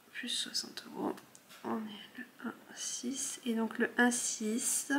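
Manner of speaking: slow whisper to herself. Real, not acted. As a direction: whisper the numbers slowly while writing. Noting amounts to herself.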